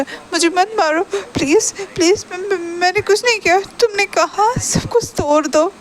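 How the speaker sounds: high, tearful pleading; crying voice. A narrator's reading. Shahjahan begs Ibo not to hit him, crying voice. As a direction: act a frightened, stammering cry for mercy. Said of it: "crying voice में था".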